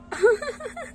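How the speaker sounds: high bright giggling laugh; high giggle. Acted. Bride giggles at the cheering relatives. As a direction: giggle at the teasing. Laugh during ring game.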